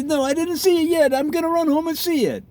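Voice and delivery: very high, hammed-up Italian accent; mock Italian accent. Townsperson in Italy answering a neighbour, mock Italian accent. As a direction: answer as an eager Italian, badly faked accent. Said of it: "Is that Italian accent?".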